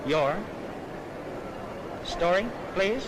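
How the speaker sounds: normal talking voice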